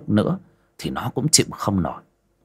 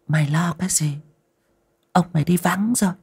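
calm his fear, luring; seductive reassurance; 9 semitones higher, faster than usual. A narrator's reading. Mistress reassures Kim the master is away, high coaxing.

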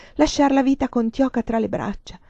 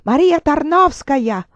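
slow, higher, louder howled name; a far-off dying voice shouting her name; dying man's shout; 5 semitones higher, 6 dB louder than usual. Acted. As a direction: hurl the name across the world. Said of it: "quella voce che urla il mio nome".

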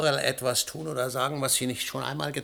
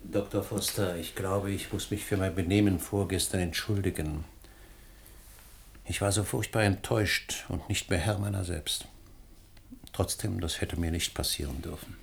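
softer and lower, contrite; subdued apology; 7 semitones lower, 7 dB quieter than usual. Acted. Husband apologises to the scientist, subdued contrite. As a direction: apologise humbly.

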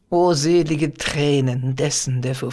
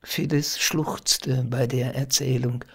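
ordinary delivery, nothing marked

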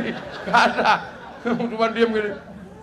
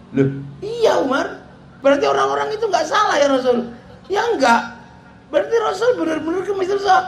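high, fast, disbelieving questions with a laugh; incredulous; 10 semitones higher, faster than usual. Retold or imitated. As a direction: play Umar unable to believe his ears. Umar's incredulous reply.

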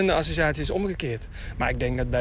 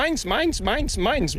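high squawky seagull chant "mines"; Finding Nemo seagulls; 8 semitones higher, much slower than usual. Retold or imitated. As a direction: squawk it like a greedy cartoon gull. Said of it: "en die roepen allemaal".

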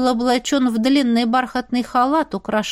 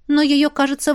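ordinary delivery

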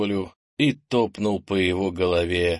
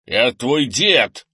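loud, slow, raised-pitch protest; indignant; 4 semitones higher, 7 dB louder, much slower than usual. A narrator's reading. Grandfather protests to his grandson, indignant. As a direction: stretch each word in outrage. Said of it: "возмутился Крис".

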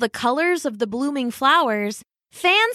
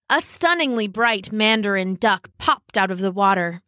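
usual speaking voice

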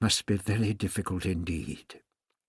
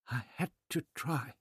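small, soft, higher voice; barely audible; 6 semitones higher, 7 dB quieter than usual. A narrator's reading. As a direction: answer faintly, hardly getting it out. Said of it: "She was barely audible".

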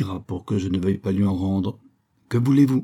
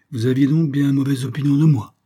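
louder, higher, affronted challenge; gruff, haughty; 5 semitones higher, 4 dB louder than usual. A narrator's reading. Anatole confronts the colonel about his bad opinion, gruff and haughty. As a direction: throw it out as a haughty demand. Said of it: "Demanda Anatole d'un ton rogue".

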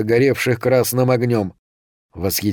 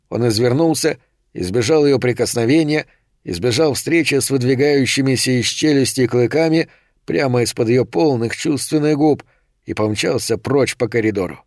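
usual speaking voice

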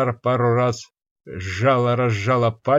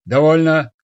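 sharp loud command, higher; commanding shout; 4 semitones higher, 8 dB louder than usual. Acted. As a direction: bark the order to stop. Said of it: "богиня скомандовала".

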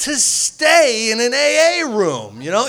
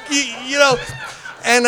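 ordinary talk, nothing marked

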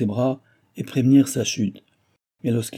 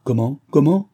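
questioning, jumping louder and higher; angry impatience; 4 semitones higher, 6 dB louder than usual. A narrator's reading. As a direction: demand an explanation, more sharply each time. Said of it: "d'un ton de colère et d'impatience".

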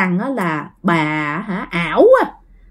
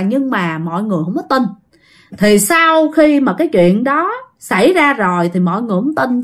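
ordinary delivery, nothing marked

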